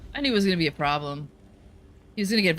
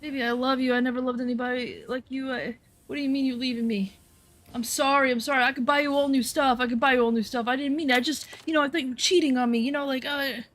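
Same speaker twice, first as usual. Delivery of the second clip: imitated crying plea; 7 semitones higher than usual. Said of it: "calls me up, crying".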